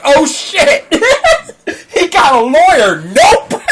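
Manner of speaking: a scared caller bailing out; mock panic. Scammer hears the lawyer and panics, high and loud. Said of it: "immediately hung up and was like".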